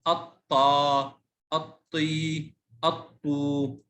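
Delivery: الطا، الطي، الطو with long held endings; long vowels. Shown as a demonstration. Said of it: "مع مد".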